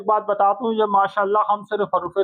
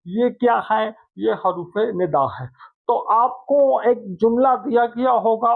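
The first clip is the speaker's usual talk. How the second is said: ordinary delivery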